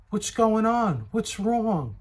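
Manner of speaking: mock the wife's worried question. Imitating his wife asking what's going on. Wife voiced in retold argument.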